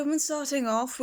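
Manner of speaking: ordinary talk, nothing marked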